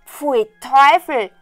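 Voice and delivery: loud, slow, drawn-out revulsion; disgusted. A narrator's reading. Brothers see what the simpleton found, disgusted. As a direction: spit it out in disgust.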